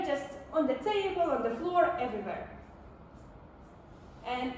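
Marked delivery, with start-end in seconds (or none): none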